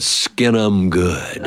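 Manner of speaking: very slow, drawn-out dry command; daddy's order. Retold or imitated. Daddy telling the barber to shave them, very slow and dry. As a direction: hand down the verdict slowly and dryly.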